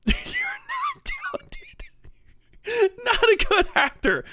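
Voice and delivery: high, squeaky voice cracking with laughter; helpless laughing. Real, not acted. Comic mocking a director's acting, breaking up laughing. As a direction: push the words out through laughter.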